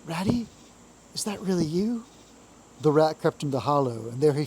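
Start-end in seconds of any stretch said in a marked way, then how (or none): 0.0-2.0 a lightly voiced, higher frail little voice; a hint of a weak small animal calling out; feeble voice. A narrator's reading. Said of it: "came a feeble voice".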